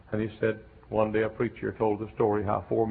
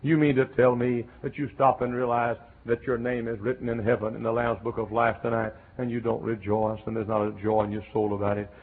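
usual speaking voice

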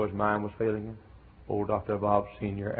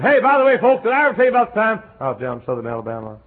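high, loud, fast cheerful aside; hammed-up eager old preacher; old Doctor Bob; 11 semitones higher, 10 dB louder, faster than usual. Preacher imitates old Doctor Bob starting his story again. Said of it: "And he said".

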